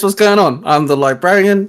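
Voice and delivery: ordinary talk, nothing marked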